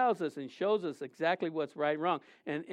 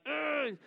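short high groan, lightly demonstrated; frustrated groan; 8 semitones higher, 5 dB quieter than usual. Shown as a demonstration. Showing the congregation how he vents his hurt, a high groan. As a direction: let out a pained grunt as you beat your chest. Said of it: "You go like".

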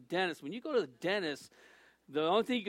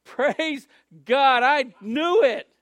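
loud joyful praise; 6 semitones higher, 13 dB louder, much slower than usual. Retold or imitated. Answering her, praising together.

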